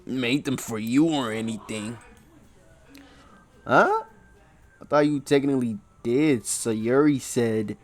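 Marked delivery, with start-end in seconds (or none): 3.7-4.0 surprised huh. Acted. Tsundere Natsuki voiced.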